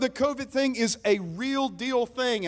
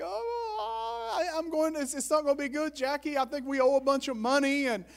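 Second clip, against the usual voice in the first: high, hammed-up wail of dread; mock tearful fretting; retold fearful whimper; 5 semitones higher, 3 dB quieter than usual. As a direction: imitate your own panicky whining, pitched up and softer. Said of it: "fearful of this".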